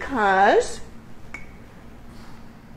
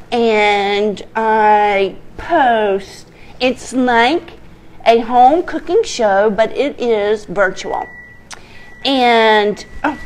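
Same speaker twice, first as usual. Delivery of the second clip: usual speaking voice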